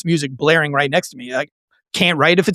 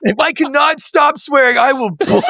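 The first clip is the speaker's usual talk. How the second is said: higher hammed-up oath; mock dramatic vow; 8 semitones higher than usual. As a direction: overdo a desperate promise, half laughing.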